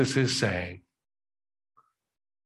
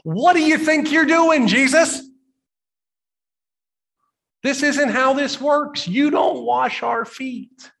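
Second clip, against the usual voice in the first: much higher, loud protesting disciple; indignant Peter; 13 semitones higher, 4 dB louder than usual. Retold or imitated. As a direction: cry out in shocked protest. Simon Peter says.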